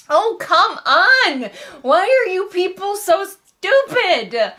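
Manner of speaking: loud, slow, fed-up high-pitched cry to the heavens; high exasperated yell at a book; exasperated outburst. Real, not acted. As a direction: throw your hands up at the characters' stupidity. Ranting at dumb book characters.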